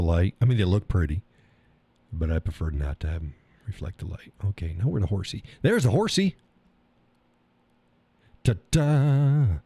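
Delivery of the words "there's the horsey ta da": high, bright ta-da announcement; excited reveal. Real, not acted. Maker shows viewers the horse coaster, excited. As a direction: present it like a magic trick.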